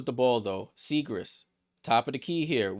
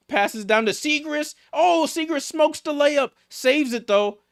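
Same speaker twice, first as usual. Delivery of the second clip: shouting the play as it happens; excited call; 12 semitones higher, 4 dB louder than usual. Real, not acted. Streamer calling a scrappy layup, rising excited call. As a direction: pitch jumps way up and louder as the layup drops.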